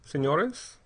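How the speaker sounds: mildly apologetic address, lifting at the end; apologetic, questioning lift. A narrator's reading. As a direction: approach them with a tentative apology. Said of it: "he said apologetically".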